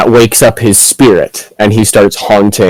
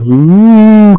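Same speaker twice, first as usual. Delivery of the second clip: spooky ghost moan; 11 semitones higher than usual. Retold or imitated. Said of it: "rather than just be like".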